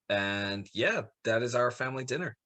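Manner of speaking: ordinary talk, nothing marked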